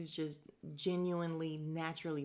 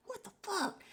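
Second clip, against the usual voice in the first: pitched-up what the fuck; high disbelief. Real, not acted. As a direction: squeak it in disbelief. Disbelief at the show.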